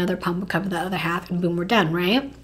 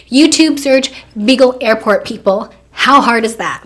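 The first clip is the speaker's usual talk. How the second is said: loud exasperation; 3 semitones higher, 9 dB louder than usual. Acted. Exasperated that her boyfriend can't repeat a simple search, loud. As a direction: punch out each word as if it's obvious.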